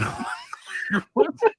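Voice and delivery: high squealing laugh. Real, not acted. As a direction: let out a shrill laugh. Laughs at Keaton's plan.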